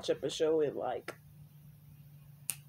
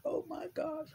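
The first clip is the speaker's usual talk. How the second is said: high squealing oh my God; gushing; 12 semitones higher than usual. Real, not acted. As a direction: squeal it with delight. Gushing over daughter.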